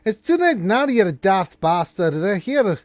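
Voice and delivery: high and loud, scolding; dwarf snapping angrily. Acted. Dwarf scolds the elf, high loud anger. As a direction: bark at the useless elf. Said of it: "And the dwarf's like".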